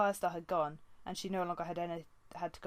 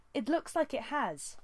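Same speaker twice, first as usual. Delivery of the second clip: higher, slow defiant retort; arguing; 8 semitones higher, 3 dB louder than usual. A narrator's reading. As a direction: talk back to her father. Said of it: "Squirrelpaw argued".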